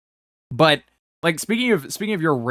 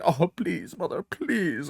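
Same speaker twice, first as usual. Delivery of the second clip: small, higher mock plea; softer mock whimpering plea; pleading child; 4 semitones higher, 6 dB quieter, much slower than usual. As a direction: beg mother weakly, voice pitched up. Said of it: "and you're just like".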